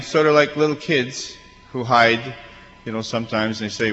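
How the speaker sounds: ordinary talk, nothing marked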